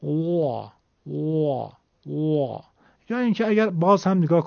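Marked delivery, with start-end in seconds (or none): 0.0-2.6 pigeon-coo imitation. Shown as a demonstration. Teacher mimicking a pigeon's call for students, cooing. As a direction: imitate a dove cooing, one slow note at a time. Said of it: "صدای کبوتر اگه دقت بکنید تقریباً همینطوره".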